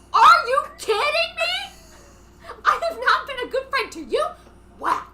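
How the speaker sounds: builds from a mock protest to a high, loud yell; acted outrage, building to a shout. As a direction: ramp it up until she's screaming at her friend. Acted quarrel, Ramona exploding.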